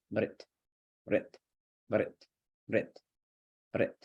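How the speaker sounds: short "brit" as one quick m-r-t syllable; syllabic r. Shown as a demonstration. Teacher sounding out M R dot T, syllabic-r "brit". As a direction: roll m straight into r in "brit", barely any vowel. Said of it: "M R dot T sounds like".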